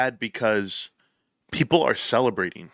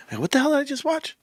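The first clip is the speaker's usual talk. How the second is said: pitched way up, fast, incredulous; stunned disbelief; 13 semitones higher, faster than usual. Real, not acted. Reacting to a shocking video, stunned and high. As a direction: blurt it out in shock.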